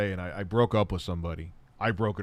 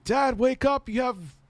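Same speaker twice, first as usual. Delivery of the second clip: much higher put-on kid voice; high-pitched mock urging; son waking dad; 12 semitones higher than usual. Son trying to wake sleeping dad, high mock voice. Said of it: "his son's, like, trying to wake him up".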